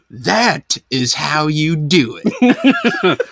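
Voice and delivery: very slow, high, loaded delivery; mock triumphant lesson. Dad revealing the harness to his crying kid, mock-triumphant. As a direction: stretch each word as a grand reveal.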